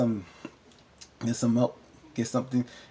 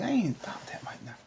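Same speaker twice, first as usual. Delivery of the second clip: whisper; 8 semitones higher, 9 dB quieter than usual. Real, not acted. Aside to viewers.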